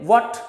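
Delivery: what with a rounded o, वॉट; correct what. Shown as a demonstration. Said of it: "इसे हम बोलेंगे what". (what को फिर से वॉट बोलिए)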